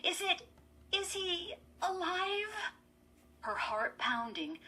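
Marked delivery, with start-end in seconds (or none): 0.0-2.5 high, halting question, throat tight; fearful. A narrator's reading. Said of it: "her throat tight with fear".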